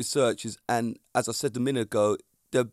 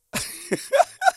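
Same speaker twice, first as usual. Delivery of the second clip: a short laugh that shoots up high; 4 dB louder than usual. Real, not acted. Podcaster cracking up at his own boast, high laugh. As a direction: squeal a quick laugh.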